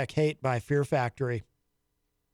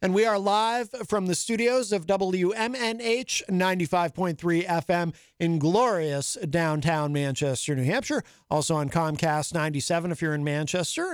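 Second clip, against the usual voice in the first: radio announcer; 4 semitones higher than usual. Acted. Radio show return announcement.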